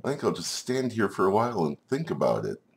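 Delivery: high-pitched, dithering little creature; confused spider. Acted. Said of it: "I'm so confused".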